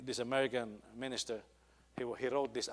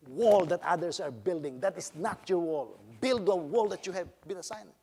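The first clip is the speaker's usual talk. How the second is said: raised, emphatic sermon delivery; forceful preaching; 6 semitones higher, 9 dB louder than usual. Real, not acted. Preacher urges believers to be content with their call, forceful and raised. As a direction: exhort the congregation with a high, driving voice.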